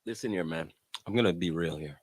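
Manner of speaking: low serious tone. Real, not acted. Announces he will get serious.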